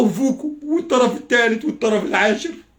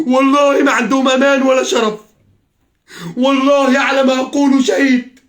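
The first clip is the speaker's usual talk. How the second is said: louder and higher, slow and deliberate; loud sworn oath; 4 semitones higher, 5 dB louder than usual. Real, not acted. Swearing before God about the rulers, loud and slow. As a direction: swear it heavily, word by word.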